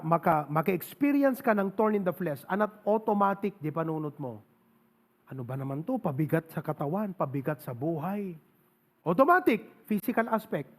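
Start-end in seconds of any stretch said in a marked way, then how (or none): 5.3-8.3 low, soft, burdened grumbling; weary complaint. Acted. Sufferer complaining of a burden in body and life, low weary complaint. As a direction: complain quietly to yourself, pitched down.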